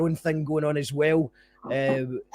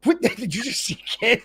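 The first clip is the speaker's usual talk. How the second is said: asked higher through laughter; laughing mock confusion; 7 semitones higher than usual. Host retelling a baffled listener, laughing. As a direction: play baffled at the slang while cracking up. Mocking confused guy hearing slang.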